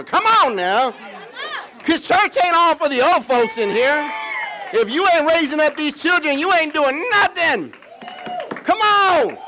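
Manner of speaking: high, loud revival preacher cry; full-force preaching. Real, not acted. Preacher rallying old folks to raise up these children, full-force preaching.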